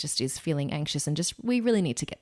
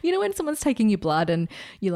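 usual speaking voice

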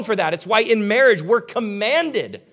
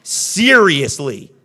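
slow, louder exasperated "Seriously?"; 2 semitones lower, 6 dB louder, much slower than usual. Retold or imitated. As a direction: drag out "Seriously?" in disbelief. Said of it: "God's looking at you like".

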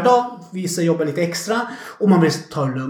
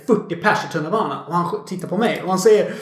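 normal talking voice

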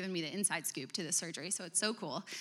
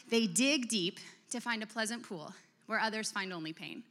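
normal talking voice

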